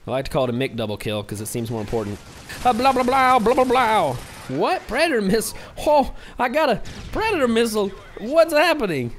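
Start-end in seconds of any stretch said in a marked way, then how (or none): 2.7-4.1 loud, high nonsense blabbing; babbling outburst. Pretend. Gamer cutting off his own explanation, loud babbling. Babbling noisily during gameplay. 4.5-9.1 high-pitched, astonished mock freak-out; hammed-up excitement. Gamer replaying his surprise at a missile, hammed-up excitement. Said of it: "I was, like, so excited".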